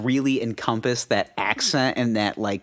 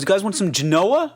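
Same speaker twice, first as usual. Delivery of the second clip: higher Jersey uncle offering Genoa; lightly voiced Jersey uncle; imitating Jersey uncle; 8 semitones higher, 3 dB louder than usual. Mimicking his uncle offering salami, mild Jersey accent. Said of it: "He goes".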